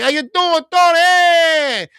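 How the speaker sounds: high, loud put-on Italian accent; mock Italian accent. Teasing coworker who looks like Mario, mock Italian. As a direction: greet him like a cartoon Italian. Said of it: "I'd always talk to him like Italian".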